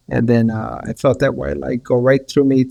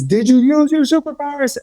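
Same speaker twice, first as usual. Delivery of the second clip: put-on much higher, louder kid's excitement; son's eager question; 11 semitones higher, 4 dB louder than usual. Son asking his dad about the rescue, high and eager. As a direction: ask like a wide-eyed child. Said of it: "my son says".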